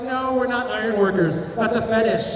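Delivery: normal talking voice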